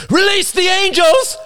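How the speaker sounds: very high, desperate screamed order; screamed command. A narrator's reading. Bearded man ordering his hesitating men, screamed. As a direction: scream the order across the mountain. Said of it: "The bearded man screamed".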